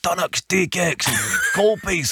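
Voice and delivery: high, slow, proud Scottish expat listing home treats; hammed-up Scottish expat. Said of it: "and he's like".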